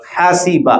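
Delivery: हासीबा with "i" after the s; correct middle vowel. Shown as a demonstration. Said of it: "असल लफ्ज हासीबा".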